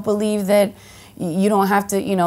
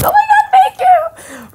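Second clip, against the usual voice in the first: very high, louder and slow, laughing through it; flustered squeal; 7 dB louder than usual. Retold or imitated. Fan thanking Salma for the compliment, a giddy laughing squeal. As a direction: gush in giddy disbelief.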